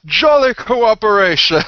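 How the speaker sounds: loud, high heroic cry; hearty comradely cheer. Acted. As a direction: hail your ally at full voice. Player cheers to knight ally.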